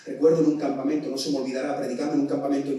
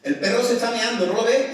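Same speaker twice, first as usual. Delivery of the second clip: parent's orders; 8 semitones higher, 6 dB louder than usual. Retold or imitated. Preacher voices a parent calling chores, loud orders. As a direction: call out chores like a mum. Parent scolding about dog.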